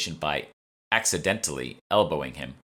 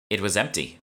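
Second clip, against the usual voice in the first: flat; 3 semitones higher than usual. Acted. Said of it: "said Emma flatly".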